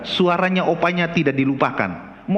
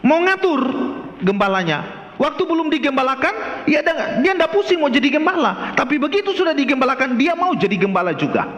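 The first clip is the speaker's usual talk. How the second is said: fervent sermon voice; preaching; 8 semitones higher than usual. Acted. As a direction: preach it to the congregation. Preaching sermon at full force.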